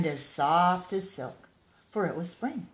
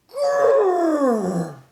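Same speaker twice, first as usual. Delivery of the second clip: loud, high rolling grrr; big bear growl; 10 semitones higher, 12 dB louder than usual. Acted. The bear releasing his held breath in a loud growl. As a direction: let out the bear's enormous growl.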